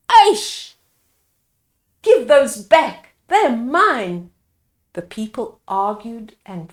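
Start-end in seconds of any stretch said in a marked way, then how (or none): 0.1-0.7 sharp high yelled aish; angry exclaim. Acted. Villager snapping at a neighbour in anger. 2.0-4.3 angry demand. Acted. Villagers quarrel.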